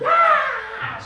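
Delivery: piercing high shrieking scream; wife's scream. Retold or imitated. Wife screaming back at husband during the argument. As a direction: let out a shrill mock scream. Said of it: "She's screaming her tonsils out".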